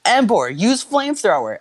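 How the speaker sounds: high trainer's battle order; commanding. A narrator's reading. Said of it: "Ash commanded".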